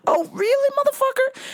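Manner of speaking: high, sneering "really"; squaring up, pitched high; incredulous taunt, way up high; mock challenge. Challenging the heckler.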